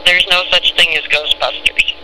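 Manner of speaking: usual speaking voice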